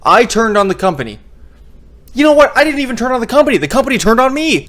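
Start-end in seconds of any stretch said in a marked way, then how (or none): 2.1-4.7 worked-up outburst. Real, not acted. Sudden outburst about turning on company.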